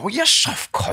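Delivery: high, teasing mock scold; affectionate insult. Mate mocking a dozy friend, high teasing scold. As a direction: call your mate daft, fondly. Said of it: "you go".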